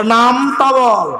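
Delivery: very slow drawn-out "tell the name"; slow pressing order; slow demand. Retold or imitated. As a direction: draw the words out to press him.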